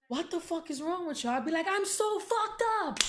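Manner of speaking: mock-yelling both sides of the row; heated retold exchange. Babysitter scolding her, she answers in a loud high cry. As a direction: voice jumps high and loud, half shouting.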